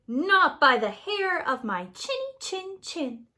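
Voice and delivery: a lightly voiced little pig standing firm; defiant little pig. A narrator's reading.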